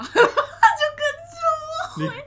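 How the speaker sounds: high, slow and laughing, half sung; laughing sing-song. Real, not acted. Telling her cohost how he followed her, laughing sing-song. Laughing retelling his obedience.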